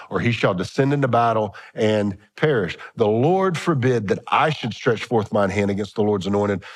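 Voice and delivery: scripture reading. Acted. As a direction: read it like old scripture. Reads scripture aloud, slow.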